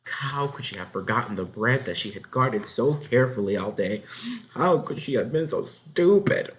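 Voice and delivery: usual speaking voice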